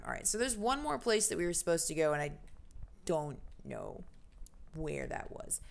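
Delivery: ordinary talk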